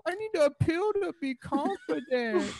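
high, squeaky frightened whine; scared wimpy voice. Retold or imitated. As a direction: whimper it like a scared little boy. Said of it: "And you're like".

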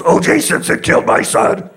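mock yell. Weary father yelling at strangers who ask, mock fury. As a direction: bark it out as a go-to reply. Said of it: "he just yells".

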